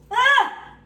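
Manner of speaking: high, loud startled yelp; sharp yelp of pain. Real, not acted. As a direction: yelp as hot oil spits. Burned by frying oil.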